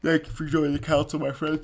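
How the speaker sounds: talking through a yawn; words stretched by a yawn. Real, not acted. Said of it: "Sorry I'm yawning".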